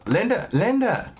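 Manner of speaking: put-on high, slow kid voice calling for attention; pleading kid. Other kid in the meme begging Linda to listen, high slow kid voice. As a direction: nag a grown-up like a small child. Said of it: "the other kid".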